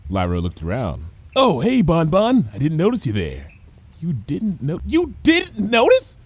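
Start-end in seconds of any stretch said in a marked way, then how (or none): 1.4-3.5 easygoing and oblivious; lightly voiced Lyra. A narrator's reading. Lyra greeting her anxious friend, casual. 4.0-6.2 frothing disbelief. A narrator's reading. Bon Bon outraged at Lyra, sputtering. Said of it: "Bon Bon frothed briefly".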